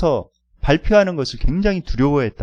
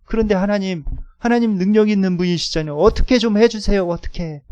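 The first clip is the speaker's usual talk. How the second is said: higher, urgent begging to God; pleading prayer; 9 semitones higher, 4 dB louder than usual. Retold or imitated. Student asking God to rescue his presentation, a retold urgent plea. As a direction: beg God to just fix it. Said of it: "하나님 앞에 막 이제 기도를 하면서".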